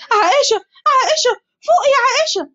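very high tearful cry; باكية. Acted. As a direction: beg her to wake, sobbing. Girls cry over fallen Aisha.

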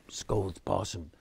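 ordinary delivery, nothing marked

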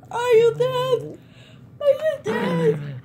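high, slow put-on voice; mock spooky question; silly slow voice. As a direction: drag out the question in a goofy voice.

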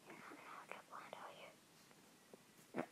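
fast breathless whisper. Real, not acted. Sneaking up on the hider, whispering fast. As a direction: whisper it fast so they won't hear.

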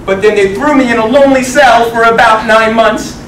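usual speaking voice

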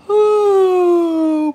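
long, loud exhale sliding gradually down from high; loud high "whoo". Real, not acted. Streamer reacts to marriage comment, loud whoo. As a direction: let out a big drawn-out hoot of breath.

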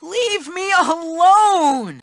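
slow, stretched-out whining plea; drawn-out plea. Acted. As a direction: drag out the plea to be left in peace. Nerd begging bully to stop.

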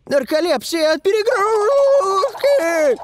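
high, slow, pleading alarm; urgent warning. Acted. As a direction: stress the danger desperately. Desperate warning of danger.

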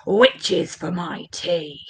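low, slow, hungry dragon. Acted. Dragon wanting witches to eat, low slow menace. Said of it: "Remember, he wants nothing better".

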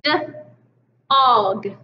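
d-og split onset and rime; segmented sounds. Shown as a demonstration. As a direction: sound out d then og. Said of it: "So I have".

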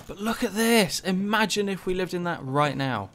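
high amazed look at this; excited. Real, not acted. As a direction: point it out in awe. Awe at game scenery.

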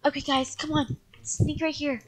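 low, very soft and secretive; hushed sneaking. Acted. Kid leading siblings sneaking up on vampire, hushed. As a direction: keep it down so she won't hear.

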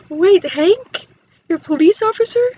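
loud surprise. Real, not acted. Player amazed that the Sim Hank is a police officer, loud. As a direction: exclaim it in surprise.

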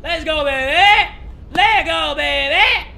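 high, loud, drawn-out victory yell; stretched-out hype shout; triumphant cheer. Real, not acted. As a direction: roar it out in celebration. Celebrates reaching the top.

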